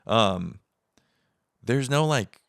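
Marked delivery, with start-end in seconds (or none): none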